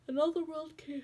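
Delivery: words stretched by a yawn. Real, not acted. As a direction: talk through a big yawn. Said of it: "I don't know why I yawned".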